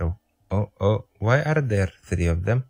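normal talking voice

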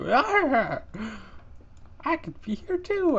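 high squeaky voice for the bat. Retold or imitated. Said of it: "He's like".

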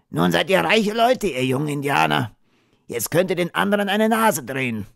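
high-pitched, needling Thomas voice; voiced character, pitched well up; teasing cowboy. A narrator's reading. Thomas teases the boys.

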